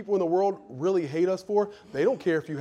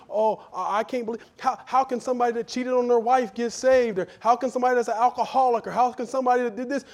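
higher, fast scandalized voice; mock disbelief; 8 semitones higher, 4 dB louder than usual. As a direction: play the shocked self-righteous churchgoer. Mocking self-righteous believers.

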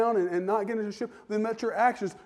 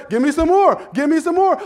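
loud, high give me some more; eager demand; 8 semitones higher, 10 dB louder than usual. Acted. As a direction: ask for more with relish. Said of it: "I'll say".

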